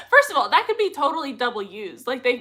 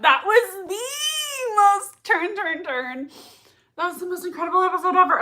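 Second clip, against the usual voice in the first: overwhelmed excitement; 6 semitones higher than usual. Real, not acted. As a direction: gush in amazement, voice pitched up. Overwhelmed praise of the episode.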